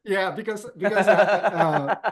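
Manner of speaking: embarrassed laughter breaking through high; laughing. Real, not acted. To the host after getting his name wrong, laughing. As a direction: giggle out the words, caught out. Caught calling him Mohammed.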